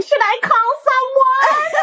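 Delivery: high, slow dithering question; mock a useless bystander; helpless friend. Mocking a friend who froze during choking, high and slow. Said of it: "you were standing there going".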